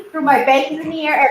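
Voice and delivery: ordinary talk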